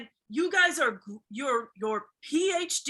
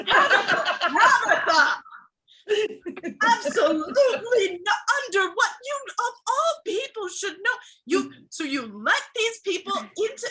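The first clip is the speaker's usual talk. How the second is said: high, loud disbelief; appalled scolding; 7 semitones higher, 6 dB louder than usual. Acted. Boss rebukes Tabitha for crying, appalled. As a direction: scold her in mock outrage.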